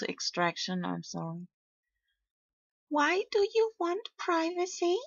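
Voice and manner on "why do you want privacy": very high, innocent kitten voice; kitty voice. Pretend. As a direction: ask it like a wide-eyed cartoon kitten. Character voice question.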